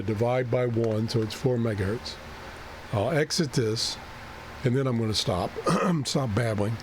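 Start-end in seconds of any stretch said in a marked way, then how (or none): none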